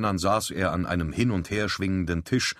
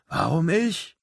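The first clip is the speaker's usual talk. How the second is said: hurt; 11 semitones higher, 3 dB louder, slower than usual. A narrator's reading. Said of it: "fragte der junge Bursche gekränkt".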